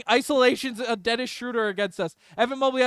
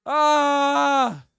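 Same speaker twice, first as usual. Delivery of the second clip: long, high cry of dismay; frustrated wail; 4 semitones higher, 3 dB louder than usual. Real, not acted. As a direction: let out one sustained high "aah". Star player subbed out.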